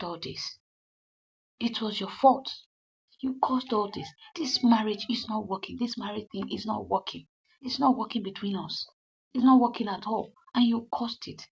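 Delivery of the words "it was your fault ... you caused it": higher, louder accusing voice; retold blame. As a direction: pin the whole mess on her. Husband blames wife.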